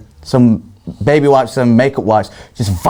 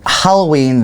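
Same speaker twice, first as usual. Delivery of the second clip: normal talking voice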